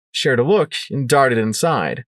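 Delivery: plain reading voice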